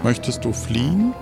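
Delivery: ordinary talk, nothing marked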